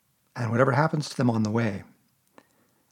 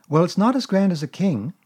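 put-on higher, brighter boy's voice asking; a small boy catching on; boyish Christopher Robin; 6 semitones higher, 4 dB louder than usual. A narrator's reading.

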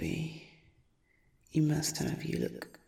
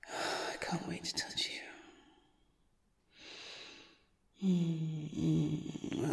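hushed leering whisper; creepy whisper; 7 dB quieter, slower than usual. Acted. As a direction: whisper it slowly and hungrily. Stalker whispers desire.

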